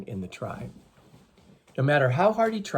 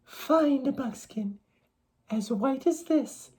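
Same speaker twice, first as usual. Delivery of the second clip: much higher, gentle maiden voice; young maiden's voice; 12 semitones higher, slower than usual. A narrator's reading. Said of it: "she told them".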